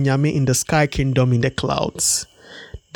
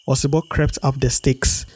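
ordinary delivery

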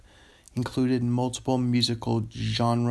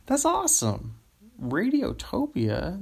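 delighted; 10 semitones higher than usual. Shown as a demonstration. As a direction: savour Radiotopia with a high delighted rise. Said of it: "That's fun".

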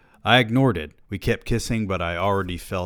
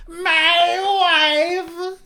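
very high, loud, drawn-out comic cry; exaggerated catchphrase voice; 7 dB louder than usual. Narrator blurting the joke he held back, exaggerated silly voice. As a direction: stretch it out in a silly high shout. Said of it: "It took everything in me not to say".

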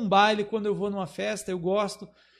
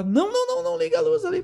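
panicked yell; faster than usual. Retold or imitated. Photographer panics over exposed film.

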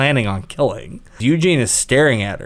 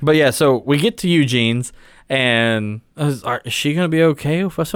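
usual speaking voice

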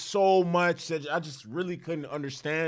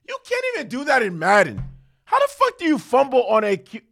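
high, loud, incredulous rant; exasperated rant; 6 semitones higher, 3 dB louder than usual. Real, not acted. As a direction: vent at the QB in disbelief. Fan ranting about fumble.

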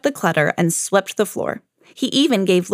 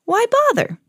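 high silly voice; 13 semitones higher than usual. Acted. Bear voice careless.

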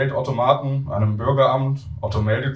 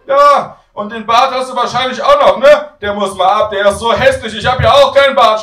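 put-on very high, booming old-lady holler; shouting granny; 11 semitones higher, 10 dB louder than usual. Grandmother misunderstanding grandson on phone, loud high shout. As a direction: shout it down the phone, mishearing everything. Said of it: "schreit Oma".

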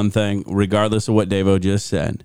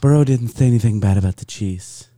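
mock impression of co-host; lightly voiced co-host impression; imitating Davo. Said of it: "That's not how I sound".

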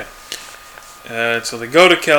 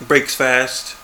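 ordinary talk

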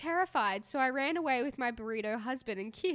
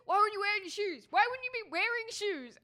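mock the dad's outraged disbelief; angry dad; 8 semitones higher than usual. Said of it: "got really mad at me".

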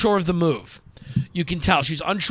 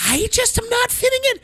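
very high whiny mock of the caller; squeaky pitiful imitation; whiny caller mimic. Host mocking the lonely caller, high whine. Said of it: "and she's like".

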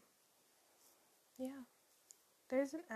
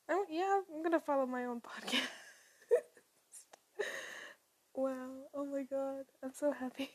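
excited; 3 semitones higher, 8 dB louder than usual. Real, not acted. Thrilled at own podcast.

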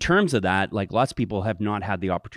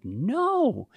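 surprised no; 12 semitones higher than usual. Retold or imitated. Emphatic answer to question.

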